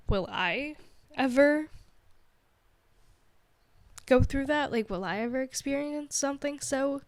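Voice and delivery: emotional, with long halting pauses; getting emotional. Real, not acted. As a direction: wonder it aloud, halting with emotion. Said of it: "I get even emotional thinking about it".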